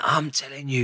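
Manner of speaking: hushed threat through the teeth; menacing whisper. Retold or imitated. Prison officer threatening the prisoner, hushed menacing whisper. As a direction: whisper it like a warning you mean.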